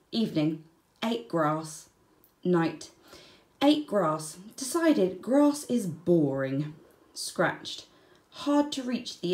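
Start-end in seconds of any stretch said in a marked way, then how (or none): none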